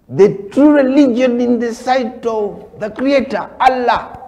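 high, loud preacher's declaration; fervent proclamation. Real, not acted. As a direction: proclaim it with ringing conviction.

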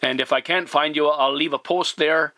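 normal talking voice

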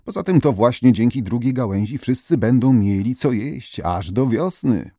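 ordinary delivery

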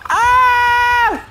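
long, piercing "ah"; high scream. Real, not acted. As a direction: let out a sustained high shriek. Yelling during the game.

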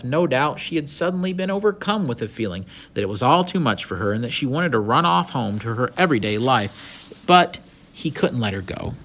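animated storybook reading, lively pitch; story narration. A narrator's reading. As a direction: read the adventure out with expression. Reads the story aloud.

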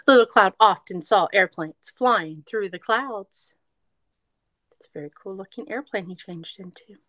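lively storybook read-aloud; reading to children. A narrator's reading. Teacher reading a picture book to kids, lively read-aloud.

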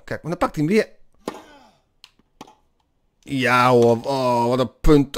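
usual speaking voice

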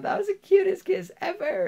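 put-on girl gushing to her date about their kiss; excited girl. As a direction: squeal it like a swooning teenager. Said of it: "she's getting all excited and happy".

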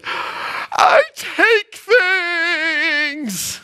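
very slow, high hammed-up wail; crying little girl. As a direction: bawl it out like a weeping child. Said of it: "starts losing it again".

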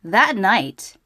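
ordinary delivery

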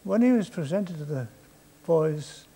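usual speaking voice

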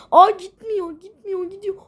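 high, loud alarmed exclamation; alarmed cry. Real, not acted. Gamer seeing the vehicle stuck, high alarmed cry. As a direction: panic as it won't move.

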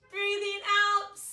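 loud, slow hissing exhale; snake hiss. Shown as a demonstration. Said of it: "make a hissing sound like a snake".

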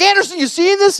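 very high, loud amazed squeal; freaking out. Acted. Man asks his friend about the shrinking knee, freaking out. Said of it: "I'm freaking out".

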